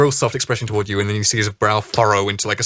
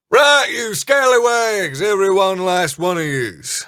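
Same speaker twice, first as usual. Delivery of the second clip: high, slow pirate shout at the crew; captain calling out; 8 semitones higher, 4 dB louder than usual. Acted. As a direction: bellow at the crew like a ship's captain.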